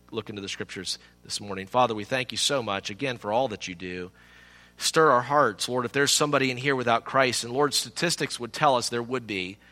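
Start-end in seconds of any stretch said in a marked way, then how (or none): 1.7-9.6 lower, softer pastoral prayer; spoken prayer. Real, not acted. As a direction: address God quietly and earnestly. Leads closing prayer.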